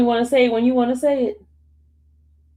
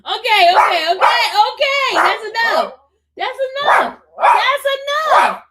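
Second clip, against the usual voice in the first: loud, high calling-off; high, insistent shout over the noise; calling over barking; 11 semitones higher, 8 dB louder than usual. Real, not acted. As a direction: shout to stop a barking dog.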